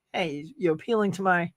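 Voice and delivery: normal talking voice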